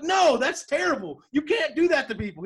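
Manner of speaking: loud, high protest; mock outrage. Nick protesting Winston's abuse of cop power, loud high outrage. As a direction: shout it like you can't believe it.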